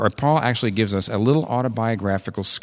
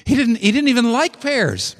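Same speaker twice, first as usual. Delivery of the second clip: incredulous; 12 semitones higher than usual. Real, not acted. Incredulous about Augustine.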